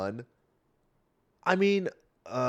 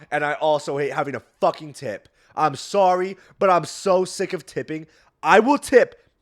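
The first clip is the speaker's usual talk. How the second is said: loud rant; 6 semitones higher, 7 dB louder than usual. Real, not acted. Ranting about tipping.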